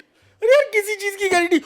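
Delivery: exasperated. Real, not acted. Teacher scoffs at a student's guarantee, high and exasperated. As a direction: throw up your hands in disbelief.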